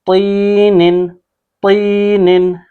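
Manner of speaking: तीनिन with a thick emphatic "t" and long "ee"; heavy Taa. Shown as a demonstration. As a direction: draw out तीनिन on a heavy first letter. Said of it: "मोटा पढ़".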